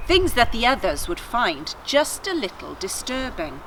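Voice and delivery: put-on steady storytelling read to children; story narration. A narrator's reading. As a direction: tell it like a bedtime tale. Reads story narration aloud.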